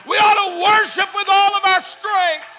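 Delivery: normal talking voice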